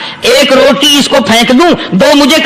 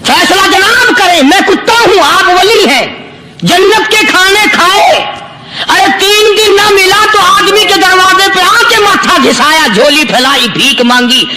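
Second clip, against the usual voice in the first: higher, emphatic pulpit voice; impassioned sermon voice, higher; preaching; 6 semitones higher than usual. Acted. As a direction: drive the point home to the congregation. Dog's reply preached.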